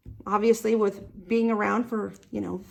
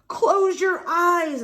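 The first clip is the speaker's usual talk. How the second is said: excited call; 6 semitones higher, slower than usual. Acted. Storytime action rhyme, calling out.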